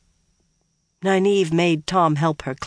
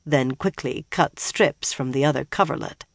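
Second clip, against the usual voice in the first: plain reading voice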